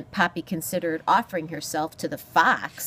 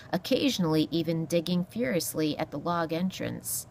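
ordinary delivery

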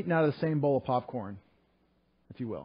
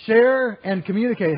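louder, higher, slower proclaiming; emphatic proclamation; 8 semitones higher, 11 dB louder than usual. Real, not acted. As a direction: ring out the verse slowly. Emphatic reading of the verse.

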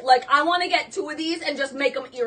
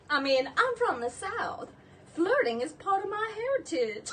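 put-on softer Southern belle accent; Blanche Devereaux; 4 semitones higher, 5 dB quieter than usual. Seller as Blanche owns up to flirting, Southern accent. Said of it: "supposed to be talking like Blanche".

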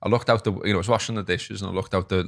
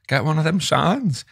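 mock indignant; 7 semitones higher, slower than usual. Retold reply, mock-indignant.

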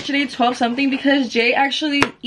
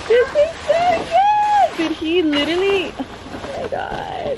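excited squeal; 12 semitones higher, slower than usual. Real, not acted. Surprise balloons from her man.

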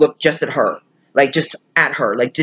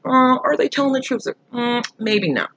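skeptical; 7 semitones higher than usual. Acted. Voices own doubt about story times.

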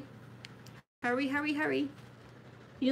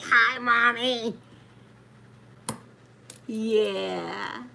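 sweet slow hello; much slower than usual. Real, not acted. Cooing hello to viewer.

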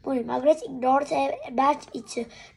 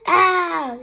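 sharp loud yelp of pain; 5 semitones higher, 10 dB louder than usual. A narrator's reading. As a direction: yelp as if kicked. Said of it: "yelped Dad".

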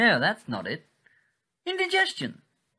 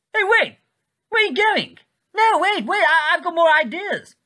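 pleading; 7 semitones higher than usual. Acted. A newsreader begging the departing viewer to come back, frantic. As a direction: desperately call them back.

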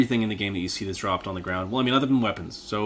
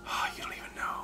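hushed ominous whisper; 12 dB quieter than usual. Real, not acted. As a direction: whisper like sharing a dread secret. Whispered reply.

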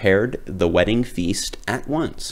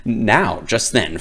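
ordinary delivery, nothing marked

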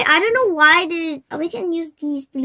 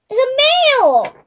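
excited; 6 semitones higher, 5 dB louder than usual. Real, not acted. Excited discovery, then repeated.

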